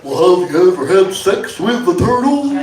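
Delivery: put-on turtle voice; comic talking-turtle come-on; turtle impression. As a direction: do a turtle making a pass. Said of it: "This is my impression of a turtle".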